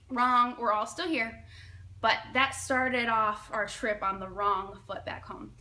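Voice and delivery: ordinary delivery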